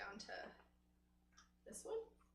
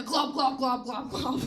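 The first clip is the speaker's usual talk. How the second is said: loud, throaty glob noises; imitated gagging glugs; 7 dB louder than usual. Comic mimics her mouthful to the crowd, glugging. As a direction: gulp out each glob as a mock gag. Imitates muffled speech during oral sex.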